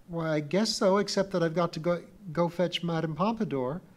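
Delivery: put-on high, eager girl's voice; a bright young girl hurrying off; little girl Pee-wee. A narrator's reading. Pee-wee answers the Mother Thing, high and eager.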